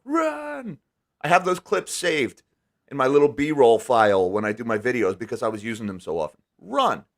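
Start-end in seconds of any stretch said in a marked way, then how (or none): 0.0-0.8 a high, urgent one-word warning; Doctor Sadler's run. Retold or imitated. Quoting Jurassic Park to warn viewers, a high urgent run. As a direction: hiss the word up high like a film heroine in danger. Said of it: "the Doctor Sadler from Jurassic Park".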